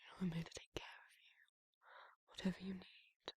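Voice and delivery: intimate whisper, close to the ear; soft ASMR whisper. Acted. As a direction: murmur tenderly to a sleepy listener. Whispered ASMR roleplay to listener.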